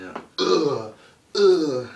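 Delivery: high, very loud strained grunting; mock grunts. Vlogger mocking Big Show's promo, loud strained grunts. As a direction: grunt like a lumbering giant. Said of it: "He's like".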